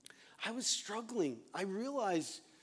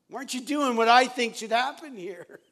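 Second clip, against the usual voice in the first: demanding complaint; 7 semitones higher, 11 dB louder than usual. Retold or imitated. Retold self demanding God's way.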